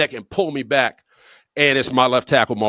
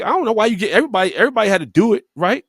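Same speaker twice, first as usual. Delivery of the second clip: higher-pitched, hurried mock complaint; retold protest; 6 semitones higher, much faster than usual. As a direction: imitate a teammate arguing his case, pitched up. Chucky protests to annoyed rookie.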